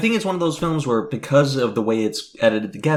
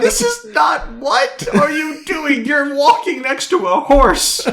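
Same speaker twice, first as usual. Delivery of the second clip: exasperated outburst; 12 semitones higher, slower than usual. Retold or imitated. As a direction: rant at the screen in disbelief. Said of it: "and I'm like".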